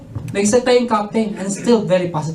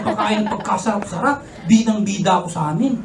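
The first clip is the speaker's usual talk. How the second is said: ordinary talk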